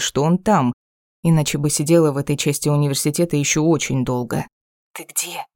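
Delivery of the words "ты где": whispered into the phone; hushed question, breath only. A narrator's reading. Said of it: "шепчет в трубку Стефа". (шёпот в трубку)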